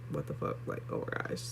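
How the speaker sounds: retold shock, dropped soft to a whisper; stunned hush. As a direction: let the breath go as the test sinks in. Stunned at second positive test.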